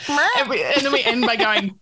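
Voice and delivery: ordinary talk, nothing marked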